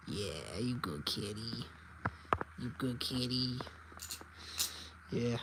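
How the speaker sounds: low, soft pet talk; soothing murmur. Real, not acted. Owner to contented kitty, low soothing murmur.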